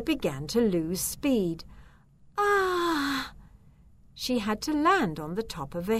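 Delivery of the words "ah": high, drawn-out falling "aah"; alarmed cry. A narrator's reading. Zelda losing speed on her broomstick, crying out. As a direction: cry out as you drop from the sky. Witch loses speed and falls.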